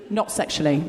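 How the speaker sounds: low, tucked-in afterthought; bracketed aside. Shown as a demonstration. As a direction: drop it down as if in brackets. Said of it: "sort of more bracketsy".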